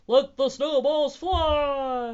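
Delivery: loud, high, stretched-out command; big drawn-out shout; rallying yell. A narrator's reading. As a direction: yell it slowly like a battle call. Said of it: "made a snowball and yelled".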